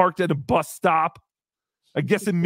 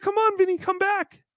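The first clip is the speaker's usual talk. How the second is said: coaxing; 12 semitones higher than usual. Retold or imitated. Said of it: "But I said".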